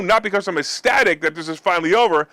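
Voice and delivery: ordinary delivery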